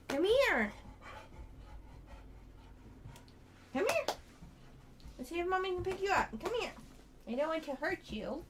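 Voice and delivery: talking to a dog. Real, not acted. Coaxing dog to be picked up.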